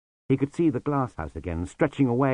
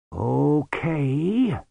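slowly; 5 semitones higher than usual. Acted. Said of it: "said the sweeper slowly".

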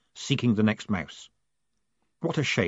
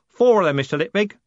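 louder, higher coachman announcing; 9 semitones higher, 5 dB louder, faster than usual. A narrator's reading. Jim telling Moist about guards, proud announcing. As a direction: proclaim it proudly. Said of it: "Jim announced".

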